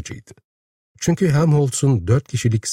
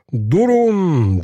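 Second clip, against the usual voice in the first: high, drawn-out call to halt; loud ringing cry; 7 semitones higher, 3 dB louder than usual. A narrator's reading. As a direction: call out over a crowd. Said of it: "Vahşi yüksek, çınlayan bir sesle".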